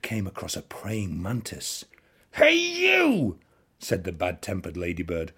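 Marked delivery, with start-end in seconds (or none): 2.3-3.4 challenging shout. A narrator's reading. Ladybird picks a fight.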